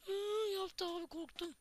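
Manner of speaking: high yelp of real fright; sudden scared squeal, then catching breath; startled shriek. Real, not acted. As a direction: jump and squeal as it spooks you. Startled by game mob.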